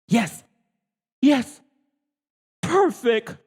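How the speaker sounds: excited shout. A narrator's reading. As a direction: shout it out, thrilled. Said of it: "shouted a man".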